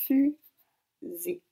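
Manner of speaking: "fusée" en deux morceaux, fu... sée, début appuyé; découpé en syllabes. Shown as a demonstration. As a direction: marquer une longue pause au milieu de "fusée". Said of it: "les cases des syllabes". («fusée» dit syllabe par syllabe, première syllabe plus forte)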